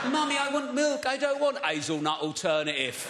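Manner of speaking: put-on posh child's whine, starting high then dropping; mimicked posh kid. Posh child refusing Mummy's milk alternative, mimicked. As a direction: imitate a demanding posh child. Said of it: "I've got half posh kids".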